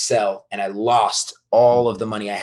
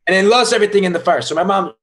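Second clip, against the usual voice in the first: rushed and excited; 8 semitones higher, 5 dB louder, faster than usual. Real, not acted. Excited retelling of mother's story.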